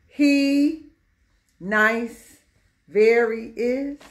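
slow, every word set apart; word-by-word reading. Shown as a demonstration. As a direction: read it out one word at a time. Teacher rereads scrambled example word by word.

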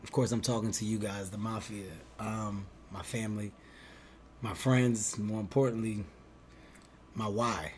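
soft, grave heartfelt confession; breaking bad news. Shown as a demonstration. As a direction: speak quietly and heavily from the heart. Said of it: "Watch, watch".

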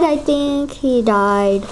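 ordinary talk

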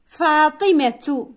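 long faa, then -timatu in فاطمة; final ta sounded. Shown as a demonstration. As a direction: say فاطمة slowly as faatimatu. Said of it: "عندما أقول فاطمة". (نطق فاطمة ببطء مع التاء: فاطمةُ)

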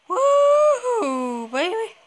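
loud, high joyful whoop; excited whoop. Real, not acted. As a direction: cheer as the plane lifts off. Taking off in the plane.

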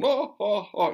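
big booming mock laugh, high and loud; a thunderous divine laugh; God laughing. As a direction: let out a huge roaring laugh. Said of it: "I heard God laughing".

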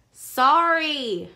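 loud annoyed shout; bully shouting his forced apology; yelled sorry. Acted. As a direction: yell it so she hears. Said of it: "Max yelled".

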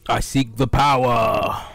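slow, loud ominous boast; hammed-up epic line; mock grand villain. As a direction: declare it like a villain.

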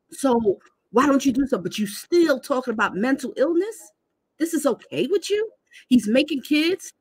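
indignant, working yourself up; outraged viewer ranting at the grandmother; rising outrage. Real, not acted.

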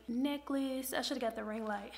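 ordinary talk

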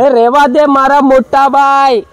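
loud, high, drawn-out call. Real, not acted. Host begging big brother to leave it be, loud drawn-out call. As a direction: sing it out long and loud to someone. Calls out to handler in Gujarati.

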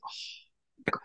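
hushed, breathy aside; a soft whispered complaint; whispered quote. Retold or imitated. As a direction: whisper it as if no one should hear. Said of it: "she goes".

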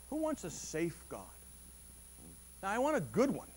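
usual speaking voice

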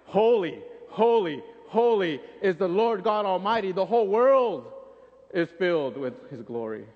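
loud, ringing holy holy holy; emphatic proclamation. Retold or imitated. Angel emphasizing the praise to his partner, loud and ringing. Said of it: "he probably emphasizes it just a little more".

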